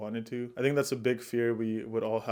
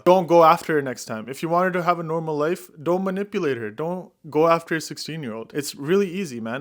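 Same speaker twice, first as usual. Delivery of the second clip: loud, higher scolding of the screen; exasperated lecture, much louder; heated rant; 5 semitones higher, 7 dB louder than usual. Real, not acted. Ranting at a movie character.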